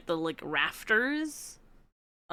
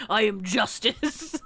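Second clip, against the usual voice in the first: Batman impression; slower than usual. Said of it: "starts saying Batman lines".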